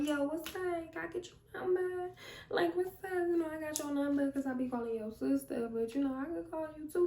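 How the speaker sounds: high, soft mock pickup lines; mock flirting. Models pickup lines for shy friend.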